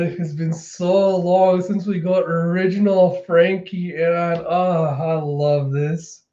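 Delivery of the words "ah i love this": ordinary delivery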